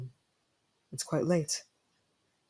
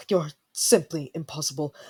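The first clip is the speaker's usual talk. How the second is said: exasperated whisper. Acted. Exasperated at the listener.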